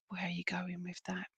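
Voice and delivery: hushed whisper off to the side; a quick whispered question meant for someone else; whispered aside. Real, not acted. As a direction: drop to a private whisper, away from the mic. Aside to the spirit, not the sitter.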